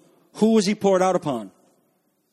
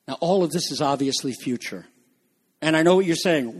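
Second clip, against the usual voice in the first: usual speaking voice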